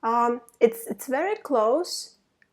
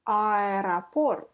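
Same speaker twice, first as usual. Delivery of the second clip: normal talking voice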